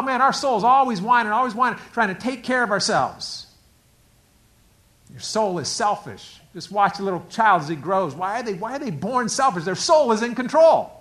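ordinary talk

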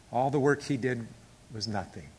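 ordinary talk, nothing marked

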